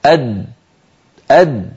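أد shut tight; pressed final dal. Shown as a demonstration. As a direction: stop the air on أد. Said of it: "جربوا لو سمحتم". (دال ساكنة مضغوطة)

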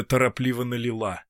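plain reading voice

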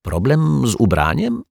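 high, curious echo question; probing, with the pitch lifted at the end; prompting question. A narrator's reading. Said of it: "ciągnął go za język detektyw Pozytywka".

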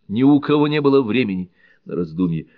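usual speaking voice

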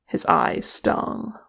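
ordinary delivery

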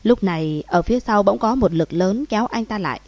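plain reading voice

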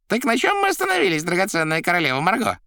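very high, quick, loud voice; Koroviev's chatter. A narrator's reading. Koroviev resuming with his queen, high quick chatter. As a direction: prattle brightly in a high register.

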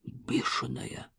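slow, hushed whisper; quiet whisper. Acted. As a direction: breathe it out in awe. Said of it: "тихо проговорил один из подростков". (тихий шёпот)

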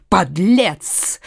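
ordinary delivery

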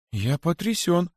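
high, slow, stunned cry of disbelief; shocked exclamation. A narrator's reading. Rabbit disciple stunned by his teacher's words, high slow exclamation. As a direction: let the shock hang on each word. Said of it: "воскликнул возжаждавший".

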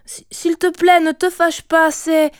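high, stammered plea; tearful pleading. A narrator's reading. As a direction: beg your mother through rising tears. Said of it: "les larmes aux yeux". (supplication au bord des larmes)

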